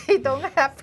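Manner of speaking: laughing. Real, not acted. Laughing about US jealousy.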